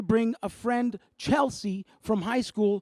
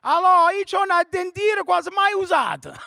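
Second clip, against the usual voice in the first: high, loud, shouted caller on a bad phone line; high loud shouting radio caller; old Italian caller; 8 semitones higher, 6 dB louder than usual. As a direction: yell into the phone like a nonna.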